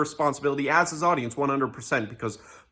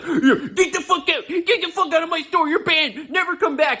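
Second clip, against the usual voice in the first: much higher, hammed-up furious shopkeeper yelling; laughing, pitched-up mock rage; mock freakout; 12 semitones higher than usual. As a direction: imitate a petty store clerk losing it at a customer. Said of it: "Ian flips the fuck out".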